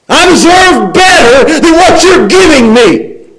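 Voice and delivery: high, loud, whiny envy act; envious grumbling. Acted. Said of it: "Grumble, grumble".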